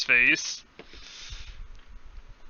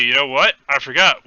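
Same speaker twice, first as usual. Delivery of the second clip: ordinary delivery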